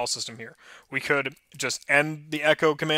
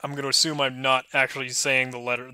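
usual speaking voice

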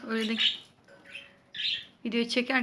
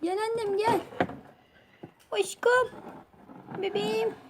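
much higher, softer pet voice; high coaxing; 9 semitones higher, 5 dB quieter than usual. Real, not acted. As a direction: coax the bird over sweetly.

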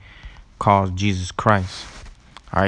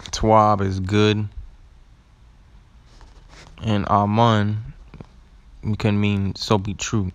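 slow teaching pace; slower than usual. Shown as a demonstration. Slow teaching of word meanings.

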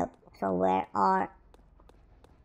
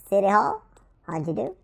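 ordinary talk, nothing marked

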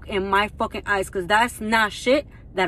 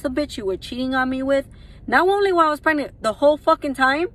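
furious outburst; 3 semitones higher, faster than usual. Real, not acted. Upset woman raging at the cheating father of her son. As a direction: let the anger explode. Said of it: "Yes, I am fucking upset".

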